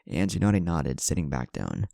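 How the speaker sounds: ordinary delivery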